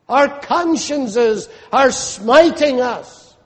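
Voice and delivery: high, urgent pulpit appeal; fervent, high-pitched exhortation; impassioned preaching. Real, not acted.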